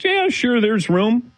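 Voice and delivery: high-pitched imitation of an obliging friend; friend's breezy agreement. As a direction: agree readily, voice lifted right up. Retelling friend's agreeable reply.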